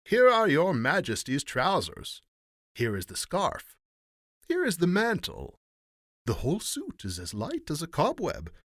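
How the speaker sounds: high, emphatic presenting voice, easing down at the end; showy salesman rogues. A narrator's reading. Rogues presenting invisible garments to the emperor, showy. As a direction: show off each piece with lilting flourish.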